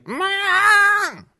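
very high, loud yell of Mom; a little girl yelling for her mother, very high and loud; child's shrill call. Retold or imitated. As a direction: shriek it like a small child. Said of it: "That's Carolyn. She talks like that".

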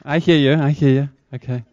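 ordinary talk

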